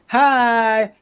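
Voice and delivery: high, loud, drawn-out hello; friendly greeting. Acted. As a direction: call out brightly to a dog. Greeting the stray dog.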